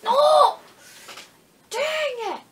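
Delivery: high, loud cry of defeat; frustrated yell. Real, not acted. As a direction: shout out the loss. Dies in game, frustrated.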